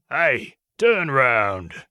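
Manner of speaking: slow, rough call from behind; rough, drawn-out monster call; sandpaper voice. A narrator's reading. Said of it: "a sandpaper voice called out from behind us".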